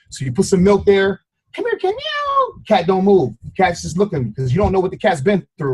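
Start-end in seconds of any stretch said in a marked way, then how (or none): 1.5-1.9 high coaxing call; calling the cat. Retold or imitated. Calling the stray cat to the milk, high coaxing call. 2.0-2.6 high, thin imitated meow. The stray cat answers, a high thin meow.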